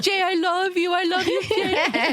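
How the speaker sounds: put-on high, gushing Colombian accent, slowing on the love; gushing delight. Wife thanking her husband for slippers, gushing Colombian accent. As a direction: swoon over the comfy slippers in a Colombian accent. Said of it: "she goes".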